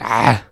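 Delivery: loud "Ah" rasped through a constricted throat; shouted fricative ayn. Retold or imitated. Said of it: "this ayn becomes a fricative".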